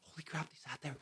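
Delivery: whispered in a panic; panicked whisper. Retold or imitated. Server panicking about a table left waiting, whispering. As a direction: breathe it out as a frightened whisper.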